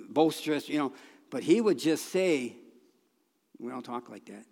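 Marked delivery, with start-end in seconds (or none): 3.6-4.4 soft, low quoted rebuke; quiet correction. Retold or imitated. Old minister to someone swearing in his presence, quiet correction.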